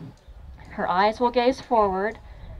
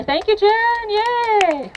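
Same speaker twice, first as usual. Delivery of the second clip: much higher, louder cheer, thank you, Jen, yay!; excited cheer; 13 semitones higher, 7 dB louder, much slower than usual. Real, not acted. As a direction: celebrate her finishing with a happy shout. Cheers Jen after her try.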